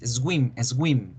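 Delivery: "swim" said as es-GÜIM. Shown as a demonstration. Teacher showing children's mistake, "swim" as ESGÜIM. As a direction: add an E and a hard G to "swim". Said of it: "como la G con la U con diéresis".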